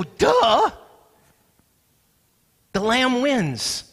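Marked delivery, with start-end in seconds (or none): 0.0-0.7 loud, high mock-obvious outburst; emphatic duh. Preacher acting his first reaction in heaven, a loud duh. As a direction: blurt it out high, as if utterly obvious.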